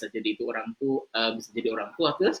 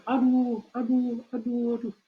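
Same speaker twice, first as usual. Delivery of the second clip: echo; 11 semitones higher, slower than usual. Acted. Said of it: "Setelah itu dia terdengar".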